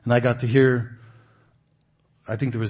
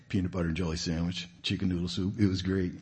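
ordinary delivery

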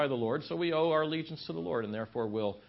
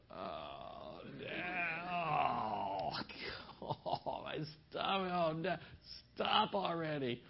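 breathless laughing plea, mostly whispered; kids laughing; 3 semitones higher, 7 dB quieter, much slower than usual. Retold or imitated.

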